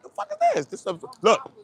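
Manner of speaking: loud, high, indignant rant; retold outburst. Retelling the argument over the cone, loud and indignant. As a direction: rant about the stupid cone, worked up. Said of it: "I'm like".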